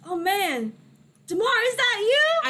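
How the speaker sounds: put-on high, loud, lightly Mexican-accented greeting; astonished uncle with a hint of a Mexican accent. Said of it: "you were gonna do a Mexican accent".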